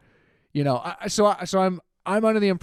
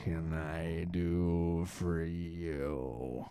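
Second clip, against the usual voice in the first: much lower, very slow, dragging drugged drone; zoned-out phone clerk; 12 semitones lower, 11 dB quieter, much slower than usual. Retold or imitated. Tire shop phone clerk answering a call, slow and dazed. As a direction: sound half asleep, each word crawling out. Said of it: "the guy who answers the phone is just".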